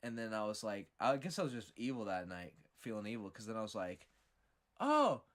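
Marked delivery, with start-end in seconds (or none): 4.8-5.2 overly cheery mock welcome, pitched high; sugary fake-nice invitation; greeting door knockers with put-on enthusiasm; fake friendly. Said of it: "put on this big old fake smile".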